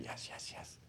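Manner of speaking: faint voiceless yeses; a barely-there whisper from a sickbed; breathless whisper. Retold or imitated. Intubated patient answering silently.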